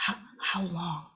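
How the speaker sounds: soft, stunned how long, faltering on the first word; hushed, barely getting the question out; husband's whisper. A narrator's reading. Husband whispering to his wife in the doctor's office, hushed and stunned. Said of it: "whispered his next words".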